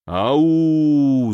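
протяжный замогильный вой привидения; завыть как призрак из могилы; загробный вой. A narrator's reading. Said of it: "завыл лакей загробным голосом". (загробным голосом тянет «Ау»)

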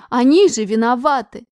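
loud tearful outcry; loud protest. Acted. Daughter insists the girls are guilty, loud outcry. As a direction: cry out against the unfairness.

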